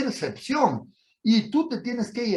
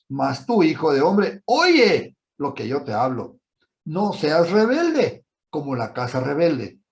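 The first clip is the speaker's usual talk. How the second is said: scripture reading; 4 dB louder than usual. Acted. Reads scripture aloud with force.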